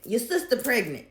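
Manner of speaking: imitating dad's loud blunt reveal; blunt announcement. Dad announces it to brother.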